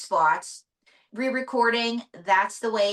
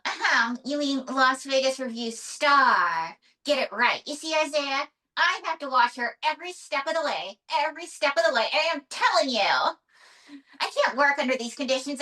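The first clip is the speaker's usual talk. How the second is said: higher-pitched huffy diva puppet; puppet diva May, indignant; 5 semitones higher than usual. Acted. Puppet star corrects her ventriloquist to the host, indignant diva. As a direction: play an exasperated showgirl puppet.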